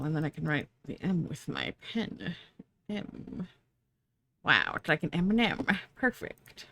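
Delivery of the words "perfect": whispering. Real, not acted. Whispered approval.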